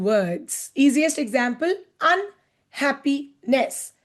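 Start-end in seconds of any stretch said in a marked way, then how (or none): 2.0-3.9 "unhappiness" slowly split as un, happy, ness; broken into parts. Shown as a demonstration. Teacher giving easiest example, "unhappiness" broken into parts. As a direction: separate the three parts of "unhappiness". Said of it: "Break it with me".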